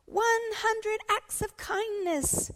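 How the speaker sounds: high, loud, slow class cheer; triumphant shout. Acted. Class celebrates reaching its kindness goal, triumphant shout. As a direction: shout it out, stretching every word. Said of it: "shouted the class".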